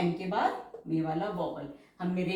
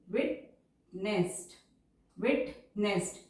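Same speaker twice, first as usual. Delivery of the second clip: slow dictation; much slower than usual. Shown as a demonstration. Dictation of closing phrase.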